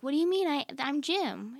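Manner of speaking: a hammed-up baffled coworker; baffled Jim. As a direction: play it innocently puzzled, up high. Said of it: "he's like".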